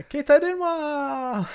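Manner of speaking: very high, stretched-out excited imitation; hyped character impression. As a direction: echo a hyped-up girl, holding the last vowel long. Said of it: "She's, like, so hype about it".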